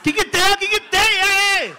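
high, loud yelling no; loud high protest. Real, not acted. Comedian to crowd: high yelled protest. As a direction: shout them down in alarm. Reacting to heckle.

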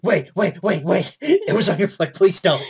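high, loud mocking echo; mock her plea loudly; loud mimicry.

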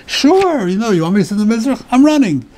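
mock overeager acceptance. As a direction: grab the honour, rushing the words. Mock accepting an honour too eagerly.

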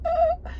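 high happy squeal; excited squeal. Real, not acted. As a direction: squeal with joy. Squeal over new car.

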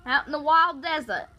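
big, hearty mock Australian; Australian accent. Shown as a demonstration. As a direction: play a broad Australian. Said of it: "Australian accent".

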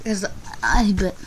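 ordinary talk

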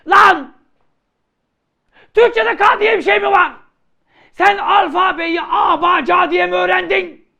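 furious shout, high and loud; angry scolding shout. Acted. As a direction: yell at the student for a silly mistake. Teacher scolds student's mistake.

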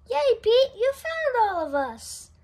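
high, loud happy cheer. Acted. The whole gang cheers Pete for finding them, excited. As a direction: cheer for your friend.

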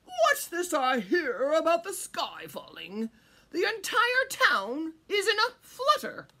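loud, high, swooping regal voice; crowing king. A narrator's reading. King asking about the falling sky, loud crowing. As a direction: proclaim it like a crowing monarch. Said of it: "crowed the king".

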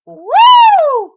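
high, loud celebratory woo; real cheer; whoop of joy. Real, not acted. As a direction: let out a victory whoop. Celebrating success.

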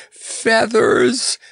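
high, gasping exclamation; gasped. A narrator's reading. Clown spotting feathers on the lion, gasping. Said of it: "gasped the clown".

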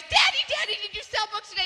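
put-on high, eager child calling; a child's excited cry to her daddy; excited kids. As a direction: squeal it like a little girl greeting dad. Kids greet dad excitedly.

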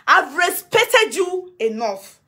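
respected stretched and stressed; measured, cold reproach; slow emphatic scolding. Real, not acted. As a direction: drag out every word to make it land. Scolding Mama Nura.

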